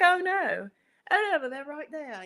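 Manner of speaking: high-pitched mock British shopper; high British accent, lightly caricatured; imitated British woman. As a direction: put on a British woman's reassuring "oh no".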